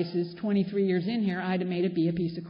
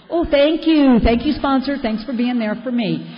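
sarcastic thanks; 4 semitones higher, 8 dB louder than usual. Retold or imitated. Sarcastic thanks to absent sponsor.

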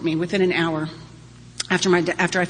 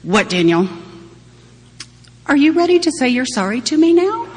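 louder, much higher mock-stern question; 8 semitones higher, 7 dB louder than usual. Mother asking her son if he's ready to apologize, mock stern. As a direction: play the stern mother, half laughing.

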